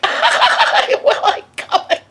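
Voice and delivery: high, breathless cackle through the words; mock hysterical laughter. Guest echoing mother's invitation, mock hysterical high laugh. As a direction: laugh the question out in disbelief.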